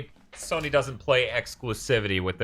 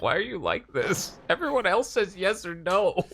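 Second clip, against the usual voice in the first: higher-pitched pleading complaint; exasperated mock quote; 7 semitones higher than usual. Event organizers complaining to Sony, exasperated.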